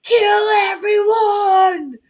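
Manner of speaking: high, slow, very loud scream; thrilled moviegoer screaming at the screen. Retold or imitated. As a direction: scream it out, hyped on revenge. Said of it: "I was like".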